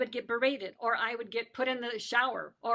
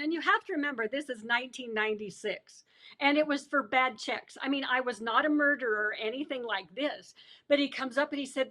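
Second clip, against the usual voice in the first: ordinary talk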